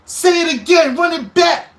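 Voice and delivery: high, loud hype-man yell from the background; mocking a hype man yelling from the crowd; hype shout. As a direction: egg him on, shouting. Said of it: "[name] was in the background like".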